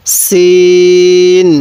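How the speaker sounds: সিন held very long, thin and firm s; thin hard sin. Shown as a demonstration. Teacher models Arabic sin, thin and hard, very slow. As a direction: draw out সিন with a thin sharp s. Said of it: "আপনি আমার সাথে পড়ুন".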